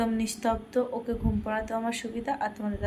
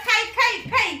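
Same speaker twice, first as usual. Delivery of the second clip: put-on baby talk; 11 semitones higher, 5 dB louder than usual. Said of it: "আ, বলো".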